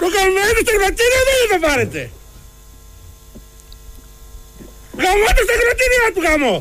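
high-pitched angry cursing; irate, pitched well above normal talk; worked-up outburst. Real, not acted. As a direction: curse the thing in exasperation. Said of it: "ηρέμησε και εσύ τώρα".

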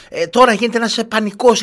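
high mock voice of a stressed mother; lightly mimicking the anxious woman; imitating a woman. Host imitates anxious mother telling her news, high mock voice. Acquaintance quoted, high put-on voice.

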